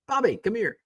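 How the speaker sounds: high sing-song call to a dog. Pretend. Owner calling the new dog Bobby, high-pitched call.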